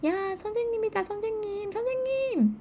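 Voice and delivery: put-on high, excited child calling the teacher; little brother calling out. A narrator's reading. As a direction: call out gleefully like a small kid. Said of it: "큰 소리로 선생님을 부릅니다".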